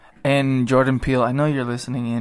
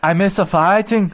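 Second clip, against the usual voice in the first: mock Irish accent, pitched up; Conor McGregor; 4 semitones higher than usual. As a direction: tease with a hammed-up Irish brogue.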